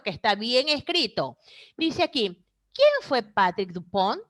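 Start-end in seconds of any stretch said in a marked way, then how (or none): 2.7-4.2 "¿quién fue Patrick Dupont?" rising at the end; question intonation. Shown as a demonstration. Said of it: "¿Escucharon la entonación?".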